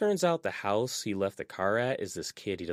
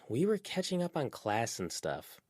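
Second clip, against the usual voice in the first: protesting; 7 semitones higher than usual. A narrator's reading. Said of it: "Verona protested".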